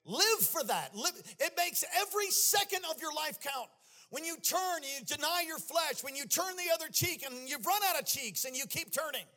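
loud, high, sing-song preaching; preaching at full force. Acted. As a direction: build it like a revival climax. Climactic preaching with music.

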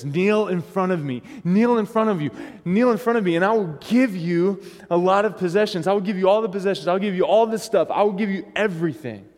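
the devil pushing Jesus to kneel; tempting devil. Pretend.

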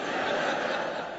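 a soft airy chuckle at the clip; breathy laugh. Real, not acted. Pastor laughing at a funny commercial he just showed, soft chuckle. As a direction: laugh quietly to yourself, amused.

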